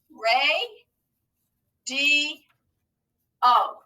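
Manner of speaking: "Ray, D, O" with a clear pause after each syllable; broken into syllables. Shown as a demonstration. Teacher breaking radio down for pupils, syllable by syllable. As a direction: say "Ray, D, O" as three held, separate beats. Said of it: "Let's break that word down into syllables".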